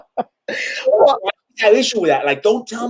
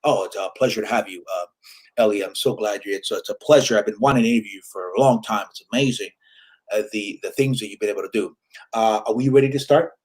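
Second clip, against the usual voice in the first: lower, softer and fast, a put-on professional politeness; imitating a smooth interviewer, lower and faster; fake-polite interviewer; 9 semitones lower, 3 dB quieter, faster than usual. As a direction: gush like a host before the cameras roll, low and quick. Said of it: "We're talking like this before the interview".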